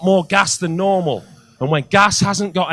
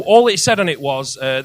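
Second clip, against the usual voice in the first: ordinary talk, nothing marked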